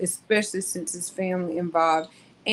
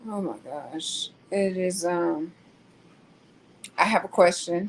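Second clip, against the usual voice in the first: ordinary talk, nothing marked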